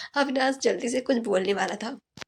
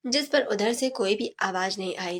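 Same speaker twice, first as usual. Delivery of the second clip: ordinary delivery, nothing marked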